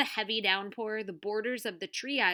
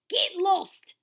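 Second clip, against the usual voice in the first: put-on loud, high Australian-accented bark; 11 semitones higher, 7 dB louder than usual. A narrator's reading. TV star drives intruders off, barked. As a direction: bark them away angrily. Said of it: "Derek barked".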